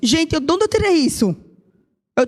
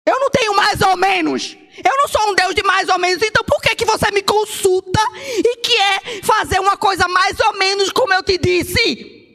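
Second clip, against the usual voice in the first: higher, insistent divine rebuke; voice of God; 6 semitones higher than usual. Acted. Preacher voices God rebuking the half-hearted believer, lightly raised. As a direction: speak as God correcting a child.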